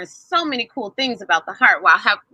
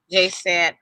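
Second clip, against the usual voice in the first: usual speaking voice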